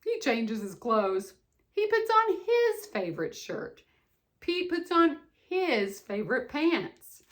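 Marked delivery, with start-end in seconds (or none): none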